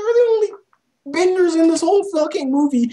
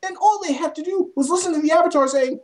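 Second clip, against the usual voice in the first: usual speaking voice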